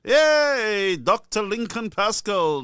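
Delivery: loud, high, drawn-out welcome; delighted cheer. Real, not acted. Host welcoming the long-awaited caller, delighted cheer. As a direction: hail the guest like a trophy winner.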